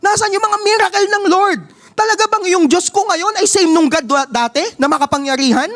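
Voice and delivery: lightly hammed-up doubting Gideon; pleading Gideon. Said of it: "Sabi niya".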